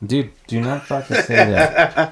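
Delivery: slow, laughing plea to stop; laughing protest. Real, not acted. To a friend naming a demon, slow laughing protest. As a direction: laugh it off while begging him to stop.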